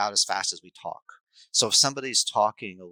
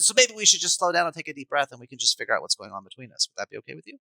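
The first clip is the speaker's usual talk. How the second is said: gearing down to calm; 6 semitones higher, faster than usual. Shown as a demonstration. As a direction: bring the kid down gently. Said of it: "and then I gear down".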